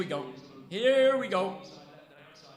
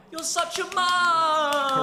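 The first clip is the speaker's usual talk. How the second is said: shout; 7 semitones higher, 8 dB louder, much slower than usual. Real, not acted. Yelling at a player.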